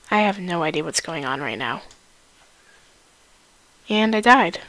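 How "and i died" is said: usual speaking voice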